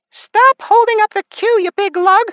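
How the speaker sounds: very high, loud fairy scolding; irritated heckle. A narrator's reading. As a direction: pipe up loudly at someone blocking the queue. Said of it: "someone hissed from behind".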